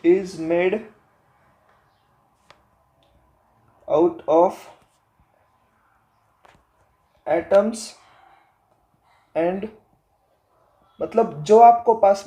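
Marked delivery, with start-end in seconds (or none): 0.0-9.7 word by word, long pauses between; dictation. Acted. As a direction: dictate slowly as if writing it out. Dictates while writing notes.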